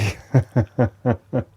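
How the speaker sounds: short, evenly pulsed chuckle; light chuckle. Real, not acted. Teacher telling students the recordings play wonderfully, light chuckle. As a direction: let out a little rippling laugh.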